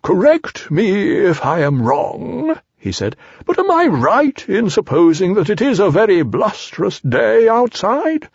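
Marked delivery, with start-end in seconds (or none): 0.0-2.6 high, drawn-out owl voice; slow, swooping storybook owl; Owl holding forth. Acted. Owl being pompous in story. 3.4-8.3 pitched-up storybook owl; Owl holding forth. Acted.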